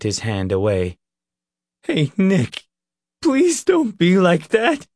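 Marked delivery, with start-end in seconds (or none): 1.8-4.9 high, pleading voice rising; begging. Acted. Leroy begging Nick after he pulled away, pleading. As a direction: beg him not to shut you out. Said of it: "Leroy begged".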